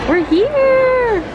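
high, loud happy announcement; thrilled cheer; excited. Real, not acted. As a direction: announce the arrival with glee. Arriving excited at restaurant.

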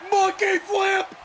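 high, slow, drawn-out announcer shout; excited commentator. Retold or imitated. Imitating the game's commentator calling a move, mock excited shout. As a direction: belt it out like a thrilled ring announcer. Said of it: "Michael Cole's excited for the monkey flip".

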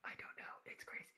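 hushed aside in a whisper; very soft, secretive whisper. Pretend. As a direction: whisper it confidentially. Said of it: "Why are we whispering?".

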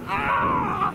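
high surprised yelp. Real, not acted. Friend falls into lava.

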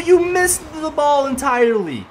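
slow exasperated complaint. Real, not acted. Repeated complaint.

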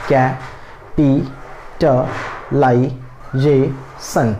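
kept-apart syllables, like a teacher drilling pronunciation. Shown as a demonstration. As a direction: split the word into slow, separate syllables with pauses. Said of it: "अब आप मेरे साथ उच्चारण कर सकते हैं".

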